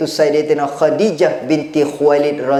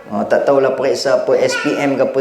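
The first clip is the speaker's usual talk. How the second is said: ordinary talk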